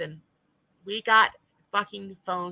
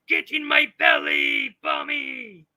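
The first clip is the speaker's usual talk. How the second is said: loud, slow, raised monster shout; hammed-up hungry roar; greedy monster caricature; 6 semitones higher, 6 dB louder, slower than usual. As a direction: bellow it like a hungry cartoon brute. Continues the monster caricature of her.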